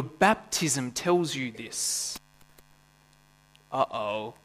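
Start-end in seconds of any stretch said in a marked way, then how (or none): 3.7-4.3 mock uh-oh. Mock dread at baptism topic.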